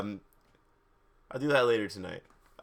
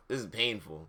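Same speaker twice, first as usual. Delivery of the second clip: plain reading voice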